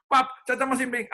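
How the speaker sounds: put-on high, quick child's voice; a little girl blurting it out in a hurry; fast little girl voice. Daughter blurts to papa after breaking a plate, high and quick.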